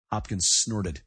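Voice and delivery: usual speaking voice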